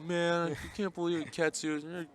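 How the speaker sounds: high whiny gripe about the game; complainer voice. Acted. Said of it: "I like your complainer person voice".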